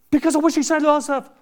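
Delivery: fast, high defensive answer; husband answering his wife, quick and defensive; defensive retort. Retold or imitated. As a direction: rattle it off in self-defence.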